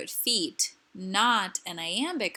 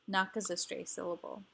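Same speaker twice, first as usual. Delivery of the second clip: ordinary talk, nothing marked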